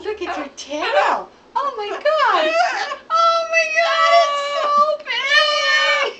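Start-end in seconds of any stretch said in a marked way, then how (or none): 1.6-6.1 high, slow, delighted exclaiming; excited shout. Real, not acted. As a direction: gush over it at the top of your voice. Delighted at the bird's tail.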